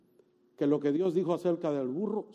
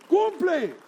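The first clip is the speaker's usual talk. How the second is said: high, loud repeated Cumple; commanding shout again; 14 semitones higher, 8 dB louder than usual. Acted. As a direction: hammer Cumple home once more.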